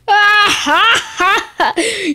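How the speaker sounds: half laugh half cry. Pretend. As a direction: crack into a laughing sob.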